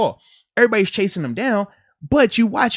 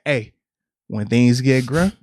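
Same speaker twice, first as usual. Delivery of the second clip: quoted coach line; 9 semitones lower than usual. Retold or imitated.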